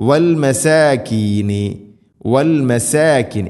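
വൽ മസാകീനി slow, with thin fronted "sae"; light seen. Shown as a demonstration. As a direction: recite മസാകീനി drawn out, keeping the s light. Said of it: "ഇവിടെയും സീനിനെയും".

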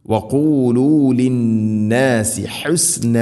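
slow, drawn-out tajweed chant; Quranic recitation. Shown as a demonstration. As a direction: chant it with long vowels and humming n. Quran teacher models the recitation.

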